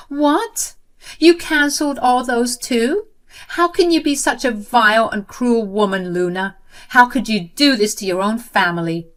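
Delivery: high, loud indignant outcry; acted outraged sister-in-law; outraged accusation. Jenny outraged at more cancellations.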